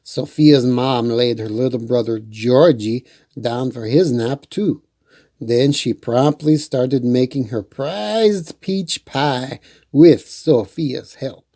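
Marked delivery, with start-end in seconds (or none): none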